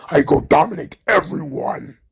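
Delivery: lightly voiced lumbering giant; mock Omos monster voice. Podcaster mimicking Omos boasting, lightly put-on voice. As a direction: mimic the big man's broken boast. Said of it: "Omos starts yelling at the floor".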